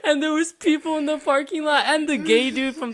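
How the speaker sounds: high, loud, helpless laughing delivery; laughing hard. Real, not acted. Telling who overheard in the parking lot, cracking up. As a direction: push the words out through real laughter.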